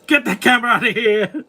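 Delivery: high, hammed-up New York accent. Reactor echoing Robert from the gag reel, playful New York accent. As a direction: imitate a New Yorker, pitched well up. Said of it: "I love his accent. It's just so New York".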